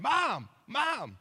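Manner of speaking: high, loud panicked shouts; yelling for mom. Retold or imitated. Half-asleep husband yelling for his mom in the night. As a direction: yell for your mom like you just woke from a nightmare. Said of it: "And I just keep saying".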